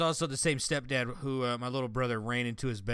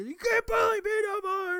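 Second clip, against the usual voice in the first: high, loud tearful yell; crying kid yell; 4 dB louder than usual. Retold or imitated. Brother standing up to the stepdad, tearful high yell. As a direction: yell it like an upset eleven-year-old.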